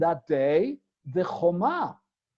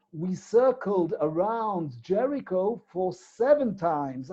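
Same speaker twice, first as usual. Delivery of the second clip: ordinary talk, nothing marked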